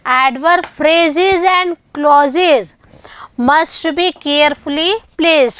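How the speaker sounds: word by word, very slow; teacher reading a grammar rule aloud to students, very slow; very slow dictation. Shown as a demonstration. Reading English rule slowly.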